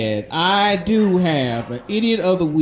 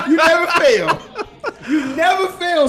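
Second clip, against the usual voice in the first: laughing disbelief; 10 semitones higher, 3 dB louder than usual. Real, not acted. Reacting to a pun.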